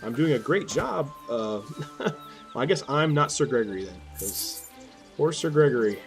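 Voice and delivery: ordinary delivery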